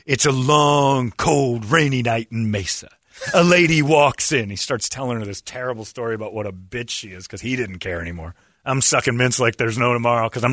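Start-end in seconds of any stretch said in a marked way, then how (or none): 0.0-4.5 very slow, drawn-out hard-boiled narration; noir storyteller. Retold or imitated. Boss [name] mocks the customer with slow noir narration. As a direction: spin it like an old detective yarn.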